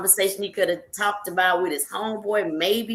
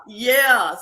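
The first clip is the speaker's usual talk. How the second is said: usual speaking voice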